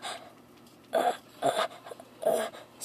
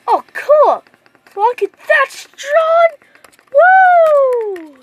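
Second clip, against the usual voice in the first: excited cheer; 8 semitones higher, 5 dB louder, slower than usual. Acted. Naruto cheers over Sage Mode.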